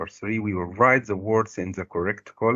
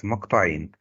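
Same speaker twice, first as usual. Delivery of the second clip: normal talking voice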